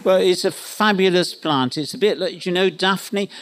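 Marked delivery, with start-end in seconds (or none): none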